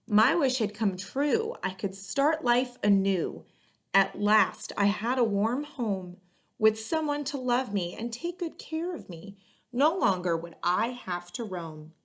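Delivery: put-on lively read-aloud to children, rhymes bouncing; story narration. A narrator's reading. Librarian reading a picture book to kids, lively and rhythmic. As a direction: storytime voice for young listeners.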